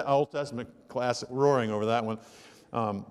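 ordinary talk, nothing marked

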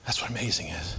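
low, soft, settled statement; hushed. Real, not acted. Preacher quietly summing up what amazing means to the congregation. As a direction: let it land low and quiet. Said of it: "repeated quieter".

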